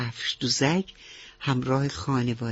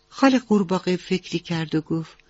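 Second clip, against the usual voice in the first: ordinary delivery, nothing marked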